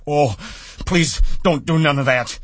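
high, loud wailing plea; frightened begging. A narrator's reading. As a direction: beg in a childish fright. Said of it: "he begged".

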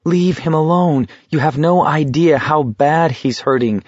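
high, pleading inner protest; muted anguished cry; inward scream. A narrator's reading. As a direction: plead for him, restrained. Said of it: "Garrity screamed out in his mind".

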